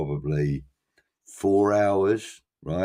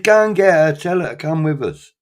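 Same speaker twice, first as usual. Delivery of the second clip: much higher and louder excited insistence; eager urging; 10 semitones higher, 9 dB louder than usual. Retold or imitated. Bob urging the teller to fetch his partner, higher and louder excited imitation. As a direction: push him to go, bursting with enthusiasm.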